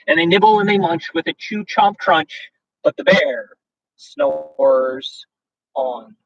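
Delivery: lively, sing-song read-aloud of a rhyming book; storybook reading. A narrator's reading. Host reading a bedtime story to viewers, sing-song.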